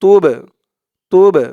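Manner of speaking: তুবে with a loud bouncing ব; echoing qalqala on ব; qalqala bounce. Shown as a demonstration. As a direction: knock the ব in তুবে. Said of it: "ধাক্কা দিয়ে পড়তে হবে".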